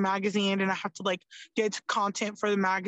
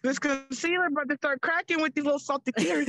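higher, playful mock relief; joking aside; 5 semitones higher than usual. Guest joking to host about hiding tears behind glasses. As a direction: laugh through the tears, teasing herself. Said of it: "I'm literally like".

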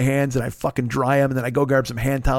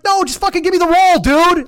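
high, loud desperate shout; exasperated yell; 13 semitones higher, 7 dB louder than usual. Retold or imitated. Stuck in the stall, shouting at his savior loudly. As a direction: yell for the roll, fed up. Yelling for toilet paper from stall.

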